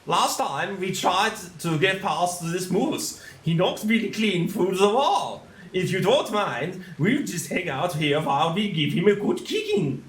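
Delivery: a put-on English accent for the sidekick. Player reading the game's sidekick in an English accent. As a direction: do the line in a light English accent. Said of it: "I'll try and do a bit of an accent, English version".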